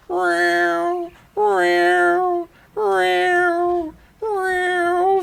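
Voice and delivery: high, long, plaintive mock meows; imitated cat meows. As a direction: mimic a lonely cat crying from the basement. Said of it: "I just hear".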